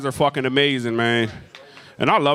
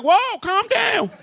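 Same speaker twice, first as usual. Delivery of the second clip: very high, drawn-out panicked cry; much slower than usual. Acted. Comic pleading with a man he thinks is armed, high panicked squeal. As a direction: squeal it slowly in comic terror.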